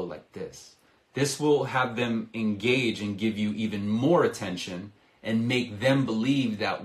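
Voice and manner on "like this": much lower and much softer, near a murmur on like this; super low voice. Shown as a demonstration. Sales coach showing how a low voice makes prospects lean in, very low and soft. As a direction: drop the voice right down low. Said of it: "lower my voice and go really super low".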